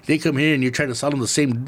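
ordinary delivery

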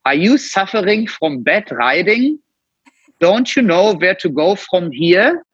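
mock advert in a hammed-up German accent; exaggerated German accent. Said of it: "that's also when then the German accent started kicking in".

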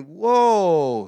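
loud, high, drawn-out whoa; stunned whoa. Retold or imitated. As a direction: act out being floored by a realisation. Retells his stunned reaction in thought.

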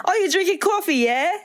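put-on high British accent with rising yeah. To Danny over coffee, high British accent. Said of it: "we're yelling it too".

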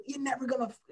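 high, pinched coach voice; mock coach rant; imitating angry coach. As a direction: cut off mid-curse as the coach. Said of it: "he said".

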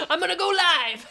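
high, loud villain echo. Pretend. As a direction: ham it up as the villain. Echoes villain line.

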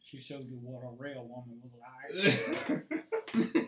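slow, laughing teasing line; laughing tease. Pretend. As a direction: draw it out through a laugh.

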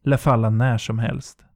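ordinary delivery, nothing marked

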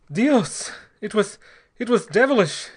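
high, loud, broken exclamation; gasped horror. A narrator's reading. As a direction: gasp it out in anguish. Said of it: "he gasped".

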